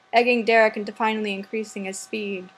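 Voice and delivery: ordinary delivery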